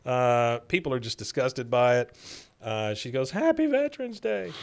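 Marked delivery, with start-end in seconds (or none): 3.3-4.5 very high put-on woman's voice; cheery parade greeting. Wife greeting the crowd from her float, mock cheery.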